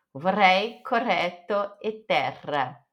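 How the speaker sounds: "Vorrei, correto, terra" with a long trilled double R; rolled double R. Shown as a demonstration. Teacher demonstrates double R to learners, long rolled R. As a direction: hold the roll on the RR of "terra". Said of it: "precisa enrolar a língua".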